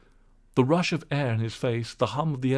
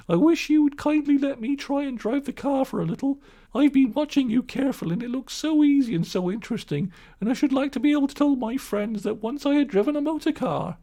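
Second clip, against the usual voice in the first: high, louder coaxing put-on voice; wheedling washerwoman; 14 semitones higher, 3 dB louder than usual. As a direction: charm the driver into it. Toad as washerwoman.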